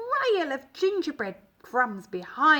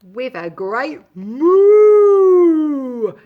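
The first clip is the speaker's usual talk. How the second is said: loud moo; 2 semitones higher, 14 dB louder, much slower than usual. A narrator's reading. Narrator imitates moo.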